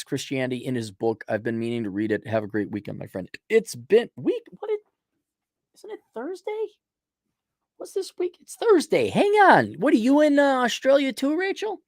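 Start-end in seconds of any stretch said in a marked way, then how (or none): 3.5-11.8 confused. Real, not acted. Confused about the day.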